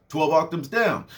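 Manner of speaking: ordinary talk, nothing marked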